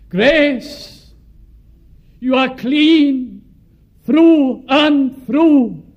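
high, very slow, drawn-out pronouncement; a solemn divine voice, high and unhurried; God speaking tenderly. Retold or imitated. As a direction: let each word of the blessing hang, slowly. God's voice quoted in letter.

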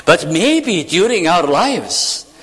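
ordinary talk

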